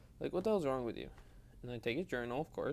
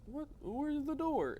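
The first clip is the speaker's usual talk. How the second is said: confused; slower than usual. Retold or imitated. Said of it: "be like".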